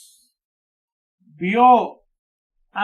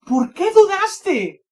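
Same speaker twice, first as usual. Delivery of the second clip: "Por qué dudaste" high, loud and drawn out as a question; interrogación enfática; 9 semitones higher, 5 dB louder, much slower than usual. Shown as a demonstration. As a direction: ask "Por qué dudaste" emphatically, slowly, pitch raised. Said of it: "Y con interrogación". ("Por qué dudaste" como pregunta alta, lenta y fuerte)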